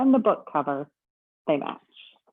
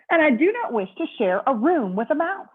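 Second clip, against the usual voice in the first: much higher, louder, indignant phone complaint; complaining guest; 7 semitones higher, 5 dB louder than usual. Acted. Mister Snore phoning the front desk about his pillow, indignant.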